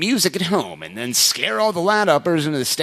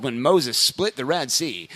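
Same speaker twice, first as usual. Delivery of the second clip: ordinary delivery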